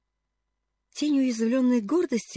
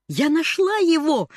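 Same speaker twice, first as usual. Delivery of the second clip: high and slow, thrilled; excited cry; 7 semitones higher, 3 dB louder than usual. A narrator's reading. Girl telling her aunt the news, excited. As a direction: announce the find with glee. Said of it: "тараторила Полианна".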